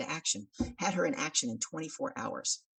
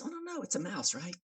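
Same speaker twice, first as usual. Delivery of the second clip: high put-on voice of the dismissive friend; mocking buddy; 6 semitones higher, 4 dB quieter than usual. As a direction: brush it off in a silly high voice. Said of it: "He goes".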